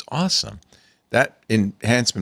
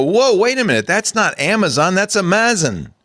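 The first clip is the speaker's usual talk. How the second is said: warning voice; 8 semitones higher than usual. Acted. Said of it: "Bitwarden will go".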